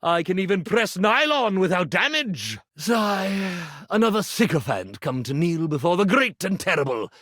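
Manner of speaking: normal talking voice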